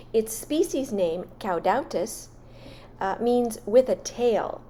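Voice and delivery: voiceover narration. Acted. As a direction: read it like a garden show host. Louder narrated voiceover with music.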